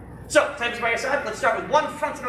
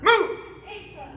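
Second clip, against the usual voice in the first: drill command shout; 10 semitones higher, slower than usual. Real, not acted. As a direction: snap the command out to the class. Instructor calling drill commands.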